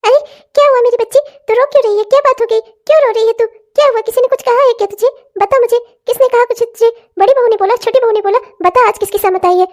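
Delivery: high, fast mother's voice fussing over her daughter; a fretting mother coaxing her crying girl; worried old mother. Acted. (बेटी को पुचकारती चिंतित माँ)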